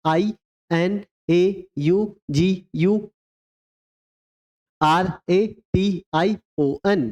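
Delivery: slow letter by letter, each one drawn out; teacher dictating letters slowly; slow spelling dictation. Shown as a demonstration. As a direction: spell it out slowly for the class to repeat. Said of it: "inauguration की spelling एक बार repeat करेंगे".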